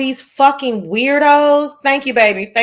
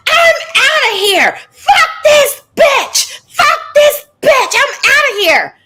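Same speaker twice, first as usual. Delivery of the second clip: much higher, much louder mocking shriek, half laughing; mock screaming exit; 6 dB louder, slower than usual. Mocking the rival storming out of the stream, shrieking. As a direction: parody her rival storming off in a screeching fit. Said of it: "And she goes".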